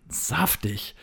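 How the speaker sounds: plain reading voice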